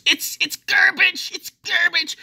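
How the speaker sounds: very high, loud squawk; disgusted outburst. Real, not acted. Condemning interest-only loans to viewers, high-pitched disgusted squeal.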